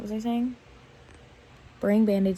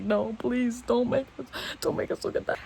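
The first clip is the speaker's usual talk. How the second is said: pleading voice, higher, fading to a breathy whisper; begging viewers; 3 semitones higher than usual. Pretend. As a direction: beg them not to make you look.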